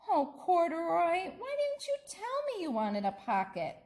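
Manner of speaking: high, fond, gently chiding; tender little girl. Acted. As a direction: coo to the bear fondly. Lisa tender to bear, voiced.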